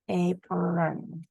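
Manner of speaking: ordinary delivery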